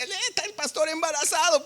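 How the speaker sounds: high-pitched crowd jeer; hammed-up taunt in a high put-on voice; imitated mockery. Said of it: "La gente se burlaba".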